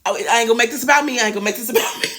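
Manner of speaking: ordinary delivery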